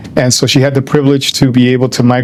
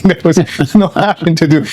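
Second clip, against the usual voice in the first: laughing; 4 semitones higher than usual. Real, not acted. Laughing while restating no option.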